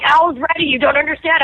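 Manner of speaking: hammed-up impatient crew member hurrying her; mock urgent insisting.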